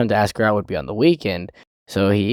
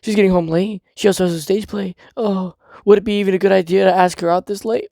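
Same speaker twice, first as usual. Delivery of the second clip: anxious fretting; 9 semitones higher than usual. Retold or imitated. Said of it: "And he's like".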